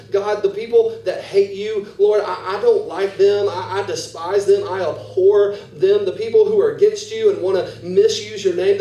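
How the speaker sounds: loud, high, impassioned pleading; fervent prayer. Acted. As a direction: pour out anger to God.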